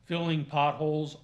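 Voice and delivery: usual speaking voice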